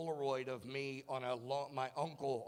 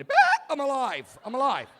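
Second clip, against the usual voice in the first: man startled awake by his mask; gasping relief; 11 semitones higher, 16 dB louder than usual. Retold or imitated. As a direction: gulp air and cry out alive. Waking from apnea.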